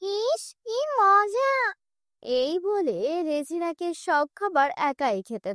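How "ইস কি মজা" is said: high, slow gloating ghoul voice; possessed mother-in-law gloating over the food; greedy witch voice. Acted.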